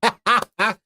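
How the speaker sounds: high burst of laughter; amused laugh. Real, not acted. Host hears co-host's baby joke, high amused laugh. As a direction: crack up at the joke.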